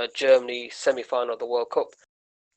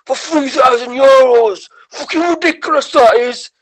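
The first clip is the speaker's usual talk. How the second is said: stupid vox-pop guy; 11 semitones higher, 7 dB louder than usual. Retold or imitated. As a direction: ham up the gullible bloke. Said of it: "He goes".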